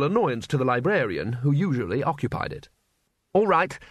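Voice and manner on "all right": put-on young wizard snapping at the council, heated; hotly. A narrator's reading. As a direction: defend your calculations with irritation. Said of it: "said Ponder Stibbons hotly".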